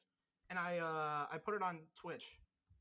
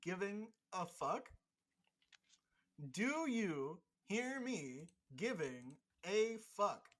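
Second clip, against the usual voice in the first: slow taunt; slower than usual. Pretend.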